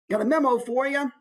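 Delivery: high, chirpy mailroom voice; eager high-pitched office junior; office worker. Shown as a demonstration. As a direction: call in to the boss with the post. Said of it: "maybe he's now in an office".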